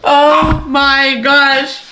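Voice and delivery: high, delighted squeals of success; thrilled disbelief. Real, not acted. Crafter thrilled her project finally worked, squealing. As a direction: burst out with joy that it worked.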